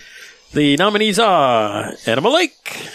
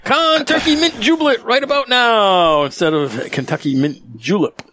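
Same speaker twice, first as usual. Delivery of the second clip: suspenseful awards presenter; 5 semitones higher than usual. Acted. Announces award winner.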